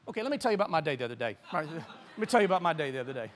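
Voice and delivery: low, quick and soft; quick undertone to the crowd; confiding aside. Real, not acted. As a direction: drop into a fast, low aside. Undercuts preaching with personal aside.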